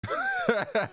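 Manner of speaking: squealing burst of laughter; shrieking cackle; high-pitched laugh. Real, not acted. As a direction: lose it laughing. Laughing at own joke.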